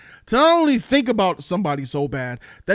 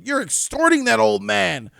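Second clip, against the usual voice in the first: accusing detective; 5 semitones higher than usual. Retold or imitated. Detective confronting the woman, hammed-up accusation. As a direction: point the finger hard at her. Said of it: "He just came to the conclusion".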